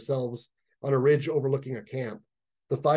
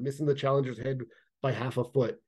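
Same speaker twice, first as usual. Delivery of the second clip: ordinary delivery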